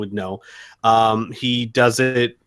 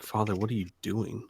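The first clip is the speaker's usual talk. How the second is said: mumbling to himself; 2 semitones lower, 12 dB quieter than usual. Acted. Character mumbles to himself about Father, quiet mumble. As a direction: mumble it softly, for nobody else to hear. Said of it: "he might mumble to himself".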